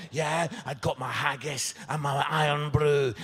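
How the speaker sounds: ordinary talk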